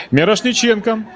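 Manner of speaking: high, punchy call of the name; excited name call. Real, not acted. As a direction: lift the voice as the attack starts. Live commentary, attack builds. (возбуждённый выкрик фамилии)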